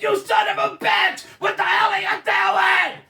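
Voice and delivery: mock outburst at a bad pass; retold yelling at TV. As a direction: replay the rant at the screen. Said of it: "I was yelling at the T V".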